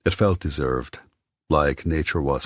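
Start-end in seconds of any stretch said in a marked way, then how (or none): none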